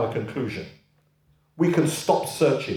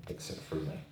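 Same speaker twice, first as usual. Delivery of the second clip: whispered, much softer, almost no voice; hushed quote; 10 semitones lower, 18 dB quieter than usual. Acted. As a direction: breathe the words out low and soft. Quoting Jesus, close of reading.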